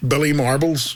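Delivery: ordinary talk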